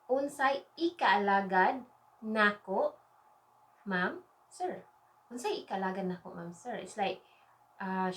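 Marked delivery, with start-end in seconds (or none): 0.1-4.9 slow, spaced-out Bisaya for learners; slow phrase model. Shown as a demonstration. Teacher models phrase, then says it quickly. 5.3-6.8 fast, run-together Bisaya phrase; quick natural take. Shown as a demonstration. Same phrase at natural speed.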